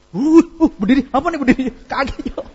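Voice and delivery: loud high startled cry. Retold or imitated. Guest jolted as the room stands, loud high cry. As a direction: jolt as everyone rises. Reenacting startled moment again.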